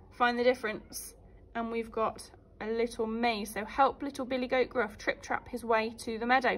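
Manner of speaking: usual speaking voice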